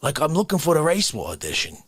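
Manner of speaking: usual speaking voice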